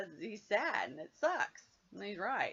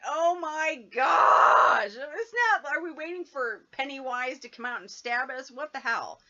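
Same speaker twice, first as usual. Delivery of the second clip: mock scared; 4 semitones higher, 10 dB louder than usual. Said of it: "I'm like".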